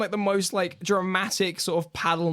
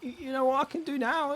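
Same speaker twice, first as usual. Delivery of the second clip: very high excited voice; relieved boatman; 12 semitones higher, 4 dB quieter than usual. Acted. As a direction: gush high and breathless with relief. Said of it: "I'm like".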